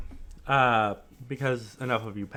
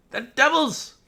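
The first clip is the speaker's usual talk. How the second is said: high, loud shrieking hag; old hag; 10 semitones higher, 5 dB louder, slower than usual. Acted. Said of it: "The hag's disembodied voice".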